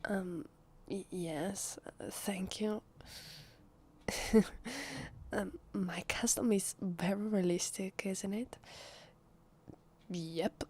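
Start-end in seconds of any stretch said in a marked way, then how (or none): 4.1-5.3 high little giggle; short chuckle. Acted. Thanked guest about a costume, short high chuckle. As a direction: give a small, airy laugh. Shy laugh after thanks.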